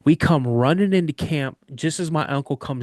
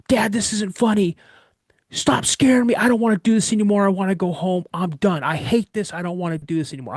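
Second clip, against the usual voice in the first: retold screaming; 6 semitones higher than usual. Scared kid screaming at dad to stop the prank, retold yelling. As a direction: holler it out like a fed-up, scared kid. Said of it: "I'm screaming at the top of my lungs".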